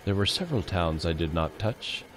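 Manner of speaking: low, hushed, solemn narration; solemn voice dropped low and quiet; solemn reading. Shown as a demonstration. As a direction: read it gravely, deep and soft. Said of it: "I need a very solemn voice for reading".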